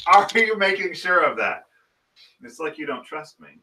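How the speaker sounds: mock offended. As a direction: act wounded by the doubt.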